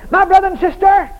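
loud preaching call; loud shouted address. Real, not acted. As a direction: call out hard to the congregation.

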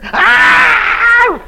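short, high, loud imitated scream; mock scream. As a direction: shriek it out like the screaming woman. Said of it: "And her still screaming".

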